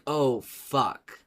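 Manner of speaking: normal talking voice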